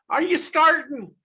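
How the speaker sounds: put-on a North Dublin lad squaring up, in a harsher Dublin accent; rough North Dublin accent. Telling the interviewer how North Dubliners talk, in a loud mock North Dublin accent. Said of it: "But in North Dublin, it's kind of".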